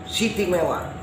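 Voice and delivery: ordinary delivery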